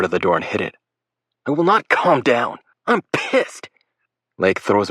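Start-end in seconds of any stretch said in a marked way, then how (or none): 1.5-3.7 high, loud hammed-up anger; fake angry yell. Will faking rage so the boys hear, mock angry yell. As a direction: pretend fury meant to be overheard.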